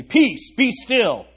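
forceful biblical command, high and loud; yelled command. Acted. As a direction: yell it at the water like an order. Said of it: "Jesus got up and yelled at the water".

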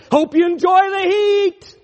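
high, loud sneering taunt. Acted. Preacher sneering at the damned, taunting. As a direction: sneer it with glee.

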